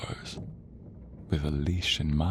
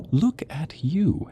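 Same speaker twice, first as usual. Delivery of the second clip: higher, lingering taunt on "Look at you"; drawn-out gloating; 9 semitones higher, slower than usual. Acted. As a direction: LOOK AT YOU stretched slowly and pitched high. Gloating repeat, building.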